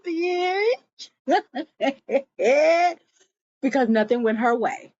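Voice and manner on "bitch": mocking laugh. Real, not acted. Mocking Matiba.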